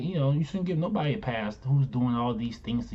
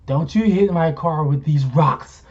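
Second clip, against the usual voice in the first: louder stern warning; 6 dB louder, slower than usual. Retold or imitated.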